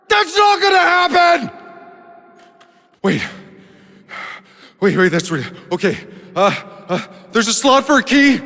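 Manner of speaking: high, loud panic, yelling for help; panicked shouting. Acted. Shouting to Zahidi as the machines crash, in panic. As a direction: scream it as everything falls apart around you.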